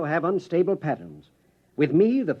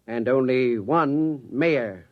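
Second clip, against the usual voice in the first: slow menace; 3 semitones lower, much slower than usual. Acted. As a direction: let the warning sink in slowly. Veiled threat to rival.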